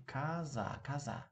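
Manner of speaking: KAZA with the first vowel said as ah; unstressed O as ah. Shown as a demonstration. Teacher explaining unstressed Russian vowels, ah sound in KAZA. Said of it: "we pronounce it as almost".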